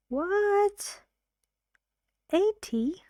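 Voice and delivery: very high, rising acted surprise; incredulous questioning. Listener questions the teacher's number to get it clear, incredulous. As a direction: play a baffled listener checking the number. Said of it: "they ask questions".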